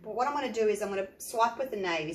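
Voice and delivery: ordinary delivery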